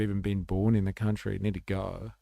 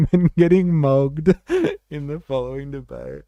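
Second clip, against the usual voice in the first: laughing; 6 semitones higher, 8 dB louder than usual. Real, not acted. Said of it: "it's such a funny word".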